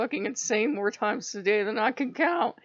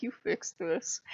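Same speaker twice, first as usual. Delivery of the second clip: softer, lower flat order; curt Odin; 3 semitones lower, 6 dB quieter than usual. Retold or imitated. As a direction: hand the problem off and walk away. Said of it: "and he's like".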